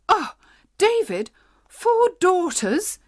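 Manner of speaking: high, slow, horrified wail; aghast, dragging out four daughters; cry of horror. Acted. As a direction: recoil at the news. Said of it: "she cried in horror".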